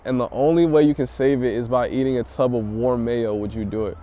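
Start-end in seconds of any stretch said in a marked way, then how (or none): none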